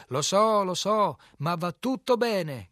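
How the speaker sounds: loud, high, merry holler back; joyful shout. A narrator's reading. Man on the bank answering the director, joyful shout. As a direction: shout it back with great cheer. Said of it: "gridò in risposta quell'altro, con un tono di grande allegria".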